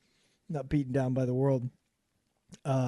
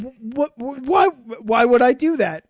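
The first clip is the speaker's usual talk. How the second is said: stammering shock; 7 semitones higher, 8 dB louder than usual. Retold or imitated. Retold shock at desk.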